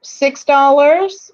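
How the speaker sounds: slow, loud bid call; auction call. Real, not acted. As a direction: draw it out, calling the room. Auctioneer calling for bids.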